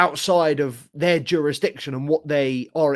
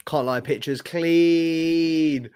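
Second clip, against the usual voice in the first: slow appreciative drawl; much slower than usual. Real, not acted. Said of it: "admiring new camera picture".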